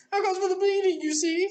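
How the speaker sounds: a high smug queen voice; smiling Queen. Acted. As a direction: redo it as the queen. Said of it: "sorry, that's her".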